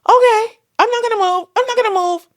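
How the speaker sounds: high, loud anxious promise; panicked agreement. Retold or imitated. Mother to surgeons, panicked high loud promise. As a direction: agree frantically, scared. Her panicked reply retold.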